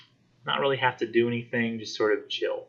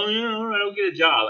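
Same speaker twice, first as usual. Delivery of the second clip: mock giving in; 11 semitones higher than usual. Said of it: "I go".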